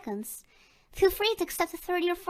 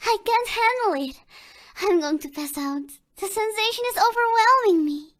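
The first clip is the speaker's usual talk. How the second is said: higher, louder, overwhelmed pretend reaction; overwhelmed giggly; 6 semitones higher, 5 dB louder than usual. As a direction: play it like the tickling is too much. Reacting to being tickled.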